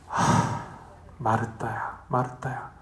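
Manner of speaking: soft sigh, then the name twice, gently; a weary sigh before calling her name; sighing Jesus. Retold or imitated. Jesus sighs softly to busy Martha, gently calling. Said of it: "한숨도 쉬셨을 것 같아".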